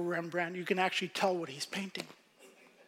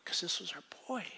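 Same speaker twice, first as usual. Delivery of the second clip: hurried whisper, much softer; fast whisper; 9 dB quieter, much faster than usual. Real, not acted. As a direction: confide it tenderly, quickly. Tender aside about their son.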